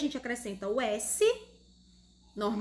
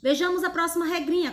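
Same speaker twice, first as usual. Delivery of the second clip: usual speaking voice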